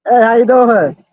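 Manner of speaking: loud, high call across the room; calling out hello. Real, not acted. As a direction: call out to a passerby, bright and loud. Greeting someone passing by.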